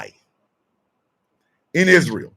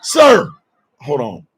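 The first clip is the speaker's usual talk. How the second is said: usual speaking voice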